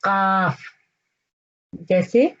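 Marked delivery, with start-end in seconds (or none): 0.0-0.6 काफ said hard and stressed from the throat; hard qaf. Shown as a demonstration. Teacher drilling the hard काफ. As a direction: hit काफ firmly from the throat. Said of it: "जोर देके बोलना है आपने".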